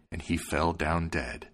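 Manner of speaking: plain reading voice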